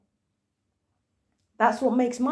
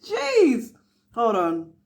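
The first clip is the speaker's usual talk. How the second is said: normal talking voice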